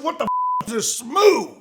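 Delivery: high, baffled question; mock bewilderment; high-pitched retold disbelief; incredulous. Retelling his own question.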